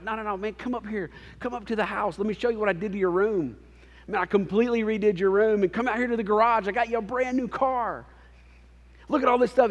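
high, loud, overjoyed father welcoming his son; overjoyed dad. Retold or imitated. Father welcoming his son home, bursting with excitement. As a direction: gush like a dad who can't contain his joy.

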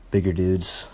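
usual speaking voice

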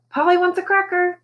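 very high squawky Polly; fake parrot squawk. A narrator's reading. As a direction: ham up dumb bird talk. Parrot fakes bird talk.

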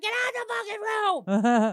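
furious high yell; shouting. Retold or imitated. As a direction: scream it at the door. Caught by son, yelling.

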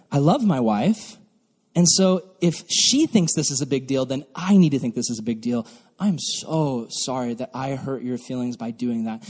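softening, heartfelt confession; sincere apology. Acted.

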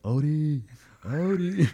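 high, loud call for a lost dog; drawn-out searching shout. Retold or imitated. Calling a lost dog from car.

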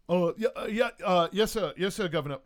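loud, high, stumbling obedience; flustered lieutenant. Acted. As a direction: answer your superior in a startled fluster. Lieutenant answers governor's orders.

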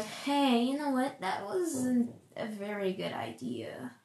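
soft voice fading into a near-whisper; body's gentle chiding. Acted. Speaker's body gently warns them after a cheeseburger, softly chiding. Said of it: "my body was like".

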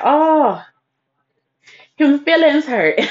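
high, babying mock sympathy; mock pity. Tipsy niece teasing her sulking uncle, mock pity. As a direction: tease them with a drawn-out pitying coo.

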